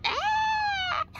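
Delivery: a very high startled shriek; sharp high yelp. Real, not acted. Vlogger shrieking in the hot sun, sudden high yelp. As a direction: yelp as if something stung.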